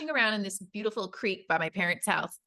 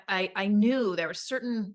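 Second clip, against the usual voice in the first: normal talking voice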